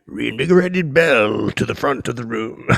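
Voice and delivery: loud, booming hype call; mock announcer. Host announcing his reinvigorated self, mock announcer. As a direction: announce yourself to a whole room, loudly.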